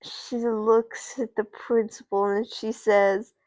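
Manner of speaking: usual speaking voice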